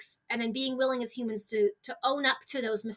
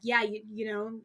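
teasing joke; 3 semitones lower, 5 dB quieter than usual. Retold or imitated. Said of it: "And I was like".

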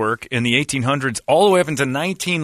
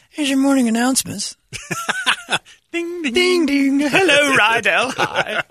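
high, loud mock school morning announcer; chirpy PA announcements with chime sounds; 4 dB louder, slower than usual. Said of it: "fourth grade news today".

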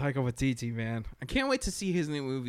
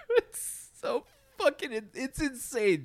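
laughing; 10 semitones higher than usual. Real, not acted. Laughing while describing.

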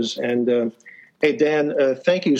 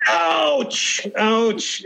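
high, pained yelp of ouch; 8 semitones higher than usual. Retold or imitated. Coins spilling in dark dorm.

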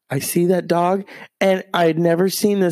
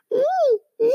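imitated dog whine. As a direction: whine like a locked-up puppy. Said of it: "and she's just whining".

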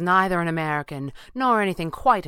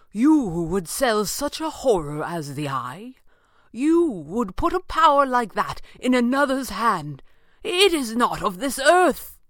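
high, slow, appalled declamation; theatrical, outraged ringing voice; ringing out in horror; 9 semitones higher, 5 dB louder than usual. A narrator's reading. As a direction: denounce it with rising force. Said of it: "Falcone's voice rang out".

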